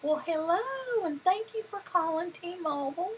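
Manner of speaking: high-pitched southern phone greeting; southern accent. Retold or imitated. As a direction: answer the phone as a sugary southern operator, southern accent. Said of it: "like, a southern accent and say".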